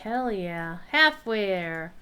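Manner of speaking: normal talking voice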